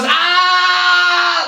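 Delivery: a sharp, high, hammed-up grunt; mock fighter's shout as if training hard. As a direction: ape his showy effort yell. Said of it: "and then he goes".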